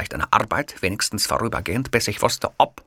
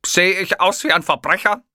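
higher, louder outrage; indignant; 12 semitones higher, 6 dB louder than usual. A narrator's reading. Hitler outraged at the kiosk man, higher and louder. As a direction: take offence. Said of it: "Ich sah ihn empört an".

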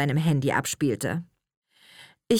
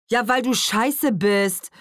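slow, louder, higher-pitched taunt; mocking teenage boy heckling; jeering interjection; 6 semitones higher, 5 dB louder, slower than usual. A narrator's reading. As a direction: throw it in as a drawn-out insult. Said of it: "warf Pavel ein".

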